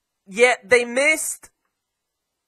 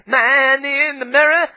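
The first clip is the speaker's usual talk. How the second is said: high quoted lyric in a put-on voice; 6 semitones higher, 4 dB louder than usual. Pundit quoting a singer at the chanting fans, mock-singerly. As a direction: pitch it up like the pop singer. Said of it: "just like what [name] says".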